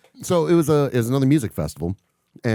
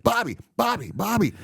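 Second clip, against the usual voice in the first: high-pitched urgent name-calling; repeated high calls to a dazed pal; alarmed buddy imitation; 12 semitones higher than usual. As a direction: act out a friend trying to rouse someone. Buddy shaking him to snap out.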